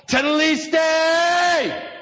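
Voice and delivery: long, high, drawn-out shout; triumphant cry. Retold or imitated. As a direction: cry it out with the last breath, full voice. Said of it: "he yelled".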